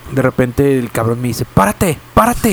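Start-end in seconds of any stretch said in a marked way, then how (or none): 1.5-2.5 sharp high yell of stop the car; retold shout. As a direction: bark out the order to pull over. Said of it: "me grita".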